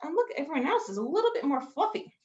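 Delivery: ordinary delivery, nothing marked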